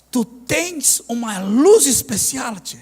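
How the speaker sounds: drawn out slow, "luz" stressed; slow solemn. Retold or imitated. Trailer guy tells the pastor he has a special light, slow and solemn. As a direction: linger on "luz" like a revelation. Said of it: "Desse jeito".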